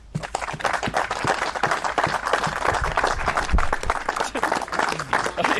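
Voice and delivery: long, loud, high burst of real laughter. Real, not acted. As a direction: let out a big helpless laugh. Long loud laugh after interview.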